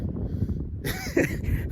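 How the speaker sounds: voiceless wheezy chuckle. Real, not acted. Man remembering a silly remark about his dog, chuckling. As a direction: chuckle under the breath before the joke. Laughs at his own coming joke.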